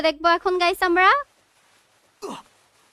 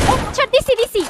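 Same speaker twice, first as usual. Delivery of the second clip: excited; 8 semitones higher than usual. Real, not acted. Got the kill.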